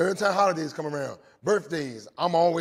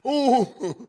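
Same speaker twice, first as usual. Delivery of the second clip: high, loud teasing ooh from the crowd; mocking ooh; 8 semitones higher, 13 dB louder than usual. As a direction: tease him like a crowd of kids. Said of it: "They were like".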